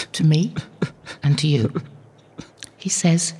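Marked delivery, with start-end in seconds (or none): none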